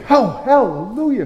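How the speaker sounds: high, bright-eyed mock hallelujah; mock joyful. Pastor showing the expected response, high mock joy. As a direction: gush it with pretend joy. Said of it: "your response should be".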